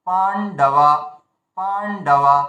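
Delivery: Pandava split by a gap after the first syllable; pause inside word. Shown as a demonstration. Teacher shows learners the air gap in Pandava, pausing mid-word. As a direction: hold the start of Pandava, stop, then finish. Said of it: "এই যে থেমে যাচ্ছি".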